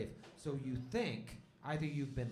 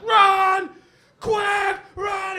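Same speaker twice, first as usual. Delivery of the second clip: high, loud panicked shouting; panicked yell; 16 dB louder, much slower than usual. Acted. Injured hunter warning the party to run, screaming. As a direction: scream it, fleeing for your life. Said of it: "And he's like".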